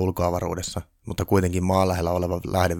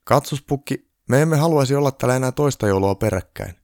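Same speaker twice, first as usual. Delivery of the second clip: sad; 6 semitones higher, 5 dB louder than usual. A narrator's reading. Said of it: "Mihail sanoi surullisena".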